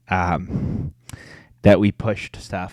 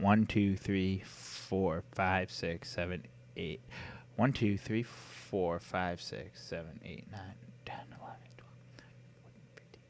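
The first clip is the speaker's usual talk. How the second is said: counting softly, fading to a whisper; counting under breath; 2 semitones lower, 20 dB quieter than usual. Real, not acted. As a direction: count to yourself, trailing into a whisper. Counting the picks to himself.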